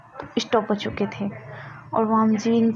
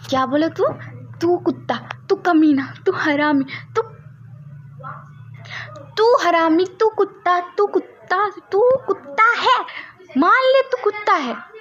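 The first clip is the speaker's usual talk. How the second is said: put-on childish name-calling; 9 semitones higher than usual. A narrator's reading. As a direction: hurl insults like a furious kid. Kids trading insults.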